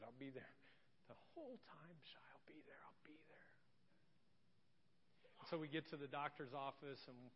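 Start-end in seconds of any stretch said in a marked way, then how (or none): 0.0-3.5 very soft, tender whisper; whispered reassurance. Retold or imitated. Father to the child clinging round his neck, whispered reassurance. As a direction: whisper it close, to soothe a child.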